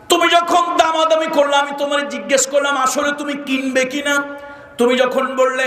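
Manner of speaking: high-pitched sermon storytelling; impassioned preaching. Acted. Preacher voicing the shopkeeper addressing his customer, impassioned. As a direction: declaim it like a preacher in full flow.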